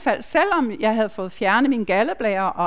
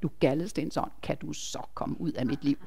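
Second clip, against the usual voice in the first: softly and low, so her sleeping husband won't hear; a hushed, firm order under the breath; quiet command; 7 semitones lower, 10 dB quieter than usual. Retold or imitated. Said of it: "stille, fordi han skulle ikke høre det".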